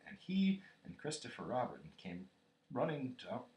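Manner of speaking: ordinary delivery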